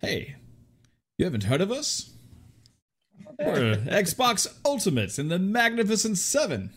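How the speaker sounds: a touch of haughty Sean Connery; pompous British voice. Pretend. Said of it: "pompous ass voice".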